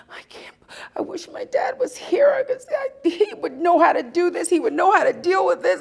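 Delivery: breathy whisper breaking into a high tearful cry; retold crying. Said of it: "So I am crying".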